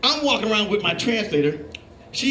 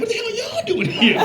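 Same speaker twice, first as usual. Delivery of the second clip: much higher, amazed and laughing; mock incredulous high voice; 14 semitones higher than usual. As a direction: toss it off in a high astonished voice.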